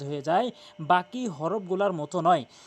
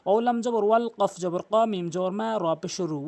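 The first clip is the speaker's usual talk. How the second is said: normal talking voice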